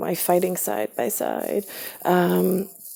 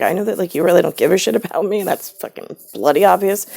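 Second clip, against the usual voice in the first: louder, fast, furious words; angry retort; 2 semitones higher, 6 dB louder, faster than usual. Retold or imitated. Son shouting back at mother, fast and angry. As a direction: let the anger spill out fast. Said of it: "then I got angry".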